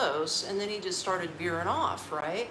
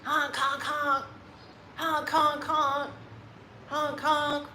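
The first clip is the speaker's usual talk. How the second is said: higher mock car horn, honking on and on; imitated honking; 7 semitones higher, slower than usual. Furious driver honking at the moped guy, mock horn. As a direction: honk away like a pissed driver. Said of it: "He was like".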